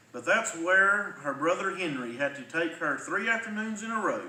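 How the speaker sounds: ordinary delivery, nothing marked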